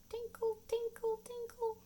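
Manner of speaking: very high, light tinkle sounds; high tinkling. Pretend. Imitating tinkling frogs for children, very high. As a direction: tinkle up high like tiny bells. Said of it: "make a tinkling sound".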